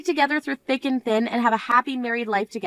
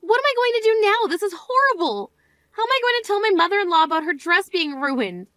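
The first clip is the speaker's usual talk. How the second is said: panicked; 7 semitones higher than usual. Acted. Bride panics over dress.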